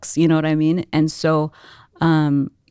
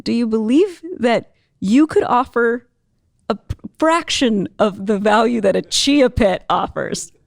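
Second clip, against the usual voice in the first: slow, deliberate, raised-pitch question; comic pointed challenge; 4 semitones higher, slower than usual. Retold or imitated. Speaker challenging tearful young lady, comically deliberate. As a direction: press the question phrase by phrase.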